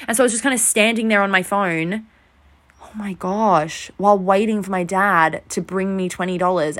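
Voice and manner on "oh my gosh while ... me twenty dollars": ordinary talk, nothing marked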